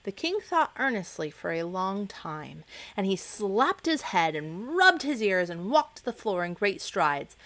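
ordinary delivery, nothing marked

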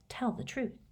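quiet recalled command. Retold or imitated. Riddle's remembered command to Dumbledore, commanding. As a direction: echo the earlier order. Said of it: "commanding tone he had used".

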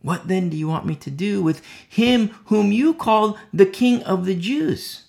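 declaiming as the governor; Pilate addressing the crowd. Acted. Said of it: "Pilate answered and said to them again".

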